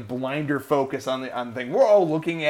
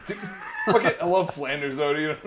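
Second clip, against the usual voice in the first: ordinary talk, nothing marked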